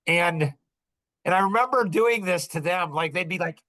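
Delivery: ordinary delivery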